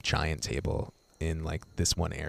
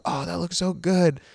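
much higher, delighted mock gush; 12 semitones higher, 4 dB louder than usual. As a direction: play the hostess swooning over the Jeep. Hostess gushing over the Jeep.

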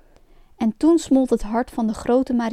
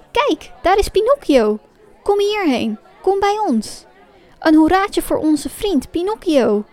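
high, loud cheering puppets; excited calling; 6 semitones higher, 4 dB louder than usual. A narrator's reading. Marionettes welcome their friend Pinocchio, calling out excitedly. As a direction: call a friend over with raised pitch. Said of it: "riepen de marionetten door elkaar".

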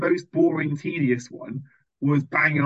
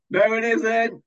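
mock camper; 8 semitones higher, 4 dB louder, slower than usual. Imitating the campers at night.

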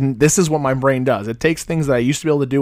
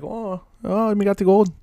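high, hammed-up Irish accent; mock Irish leprechaun pitched way up; 8 semitones higher than usual. Host teasing co-host about sounding Irish, mock Irish. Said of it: "it's almost Irish".

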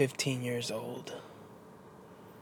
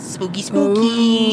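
slow, higher, louder ghostly voice; spooky voice; 8 semitones higher, 6 dB louder, slower than usual. Pretend. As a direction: draw it out like a ghost. Spooky Halloween voice.

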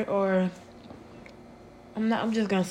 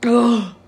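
pained groan; 4 semitones higher, 10 dB louder than usual. Real, not acted. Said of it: "My throat".

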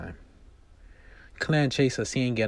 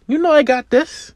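very high, loud and eager; brimming with confidence; excited confidence; 15 dB louder than usual. Acted. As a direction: Claire assuring her friends she'll land the flips. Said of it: "Claire is very excited".

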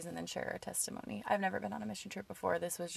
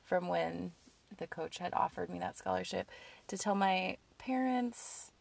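ordinary talk, nothing marked

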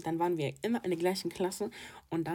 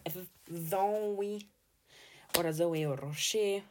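ordinary delivery

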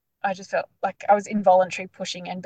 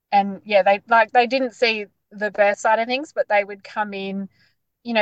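normal talking voice

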